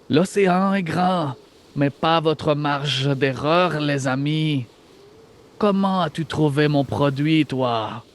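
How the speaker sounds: put-on slow, drawn-out AI voice with a Franco-German accent. Game master voices an AI villain taunting players, slow Franco-German accent. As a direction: play a slow artificial intelligence, bad Franco-German accent. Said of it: "avec une voix d'intelligence artificielle ... accent français, franco-allemand".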